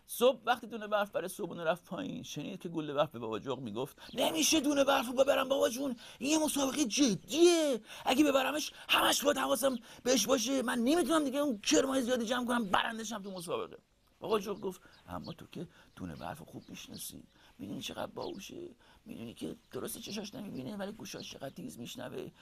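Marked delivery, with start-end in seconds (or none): 4.1-13.8 put-on high, whining child protest; high-pitched, insistent complaint; protesting young owl. Brother protests to father. 15.1-22.3 very soft, half-whispered reasoning; quiet, breath-heavy father voice; soft-spoken father owl. Acted. Father owl reassures son.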